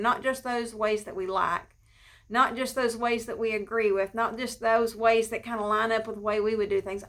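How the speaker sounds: ordinary talk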